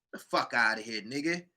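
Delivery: dropped to a soft, low scoff. Real, not acted. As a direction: mutter it away. Dismissive repeat.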